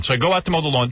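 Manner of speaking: usual speaking voice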